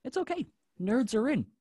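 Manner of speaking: high, hopeful little reassurance; Willow. Retold or imitated. Quoting Willow on nerds, high-pitched and reassuring. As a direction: pitch it high, ending on an uncertain question. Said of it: "like Willow says".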